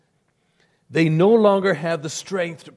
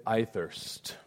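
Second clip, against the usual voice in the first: soft, dry and spent; a hushed, thirsty plea; quiet parched quote; 4 semitones lower, 12 dB quieter than usual. Acted. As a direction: let the words fall softly, parched. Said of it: "Jesus said".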